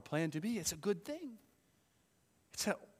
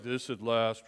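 1 flat bored reading; 5 semitones lower, 3 dB louder, slower than usual. Retold or imitated. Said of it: "this is not meant to be like".

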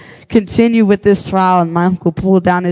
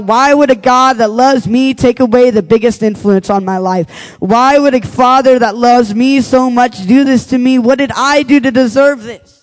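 anguished pleading cry, louder and higher; cried out; 6 semitones higher, 3 dB louder than usual. Retold or imitated. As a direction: cry out to God in pain. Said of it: "I cried out".

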